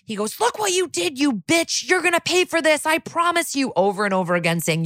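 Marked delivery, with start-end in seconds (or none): 0.4-3.7 high-pitched mock rage, not shouted; going totally postal. Defendant lunges at the prosecutor in court, imitated high-pitched raging threat. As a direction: imitate a furious outburst, pitched way up. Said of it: "Drew goes totally postal".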